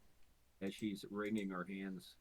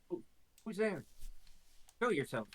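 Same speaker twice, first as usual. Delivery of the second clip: startled call; 9 semitones higher, 5 dB louder than usual. Acted. Startled Nualia calls into cave.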